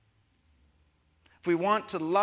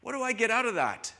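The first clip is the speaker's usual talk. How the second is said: selfish voice; 6 semitones higher than usual. Retold or imitated. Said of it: "a love that doesn't ask".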